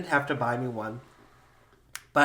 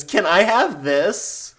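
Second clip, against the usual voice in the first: put-on high, slow whiny child; bratty kid voice; 6 semitones higher, 9 dB louder, much slower than usual. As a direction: beg like a spoiled little girl. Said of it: "your brat of a little niece goes".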